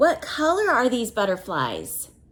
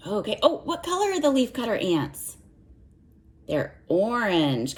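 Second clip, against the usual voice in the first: ordinary delivery, nothing marked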